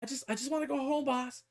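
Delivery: high, small, soft creature voice; squeaky little grot; whining grot. Retold or imitated. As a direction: plead like a tiny homesick minion. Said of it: "the grot's like".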